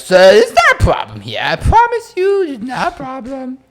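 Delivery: put-on squeaky drunk reassurance to a bouncer; drunk goblin Josh. Friend imitates drunk Josh calming the bouncer, high and loud.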